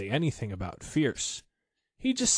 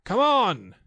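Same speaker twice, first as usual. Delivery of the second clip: high loud call to the line; 11 semitones higher, 6 dB louder than usual. A narrator's reading. Christopher Robin calls the expedition forward, loud. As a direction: shout to those far back. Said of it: "called Pooh and Piglet".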